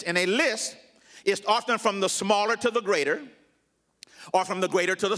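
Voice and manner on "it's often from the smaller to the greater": ordinary talk, nothing marked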